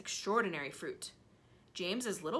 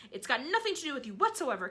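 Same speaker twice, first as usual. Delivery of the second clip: scolding; 6 semitones higher, 5 dB louder, faster than usual. Acted. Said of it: "Aunt Sponge declared".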